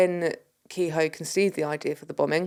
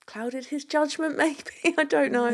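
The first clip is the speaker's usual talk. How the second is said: laughing; 10 semitones higher, slower than usual. Real, not acted. Laughing at her own theory.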